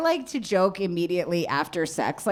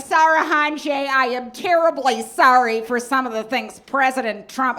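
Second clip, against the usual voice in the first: gushing mom voice; 6 semitones higher, 5 dB louder than usual. Retold or imitated. Daughter mimics her mom fawning over a cab driver's name, gushing high. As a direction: savor the name like a fine wine, way up high. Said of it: "She's like".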